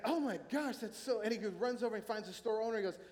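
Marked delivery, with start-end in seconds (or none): 0.0-1.2 mock affronted gasp. Customer reacts to the insult.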